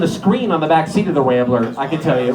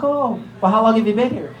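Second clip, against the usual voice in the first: higher-pitched imitated polite interest; mock friendly diner, pitched up; interested small talk; 4 semitones higher than usual. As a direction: ask brightly, as a curious stranger. Curious diners question grandma.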